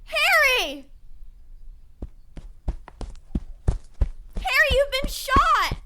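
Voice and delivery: high, urgent yell to a wounded man; alarmed shout. Acted. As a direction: cry out loudly in alarm. Alarmed companion finds him shot.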